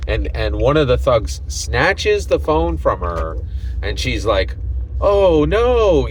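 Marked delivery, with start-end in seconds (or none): 5.0-6.1 high, louder mock alarm. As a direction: play her startled cry aloud. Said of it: "she says that literally out loud".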